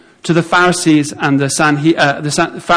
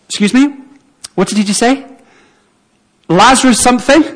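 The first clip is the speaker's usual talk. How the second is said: higher-pitched, questioning double-take; puzzled onlooker; 6 semitones higher than usual. Acted. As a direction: play someone unsure they heard right. Imagined confused onlooker mishears the call.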